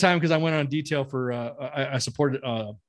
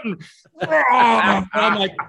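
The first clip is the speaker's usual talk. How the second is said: laughing hard; 9 semitones higher, 9 dB louder, much slower than usual. Real, not acted. Laughing at the scolding.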